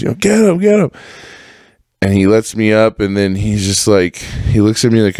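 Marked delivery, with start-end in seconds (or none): 0.1-0.9 high-pitched mock shouts of spectators; imitated crowd yelling. People on both sides of a fight, mock screaming. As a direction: chant it like onlookers at a fight. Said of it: "there's people screaming on both sides".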